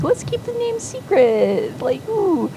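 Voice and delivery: high-pitched playful secret-keeping coo; conspiratorial glee. Retold or imitated. Couple agreeing to hide the baby's name, high conspiratorial glee.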